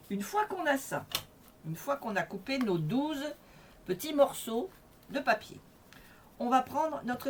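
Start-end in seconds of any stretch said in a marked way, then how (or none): none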